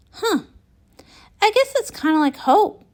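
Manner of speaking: ordinary delivery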